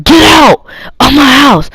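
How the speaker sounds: high mock shout; retold yelling. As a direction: shout it like an angry parent kicking someone out. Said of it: "yelling at her".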